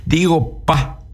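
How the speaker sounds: "pa" said light and unstressed after "digo". Shown as a demonstration. Teacher demonstrating to radio listeners, unstressed "pa". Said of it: "pero sin acentuar el pa".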